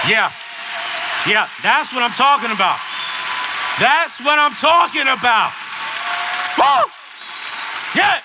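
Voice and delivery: loud, high cheering to the audience; hyping the crowd. Real, not acted. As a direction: fire up the room, big whoops.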